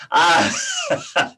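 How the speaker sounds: sudden high yelp of surprise; startled yelp. Real, not acted. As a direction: yelp out, caught off guard. Sudden yelp, maybe at cat.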